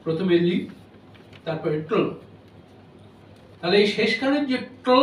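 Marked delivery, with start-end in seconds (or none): 0.5-2.0 "লি" then "ট্ল", with a syllabic l and no vowel; syllables split apart. Shown as a demonstration. Lecturer to students, breaking little into syllables. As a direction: say "লি" and "ট্ল" as two separate syllables. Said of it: "এতে দুটি ধ্বনি দল আছে".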